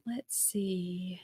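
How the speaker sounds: barely voiced whisper; whispered aside. Real, not acted. Streamer whispering to herself while looking something up. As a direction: whisper to yourself while searching.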